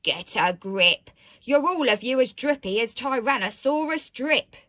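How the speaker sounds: booming storybook dad; stern dad. A narrator's reading. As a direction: scold them all as the father. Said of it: "and Dad said".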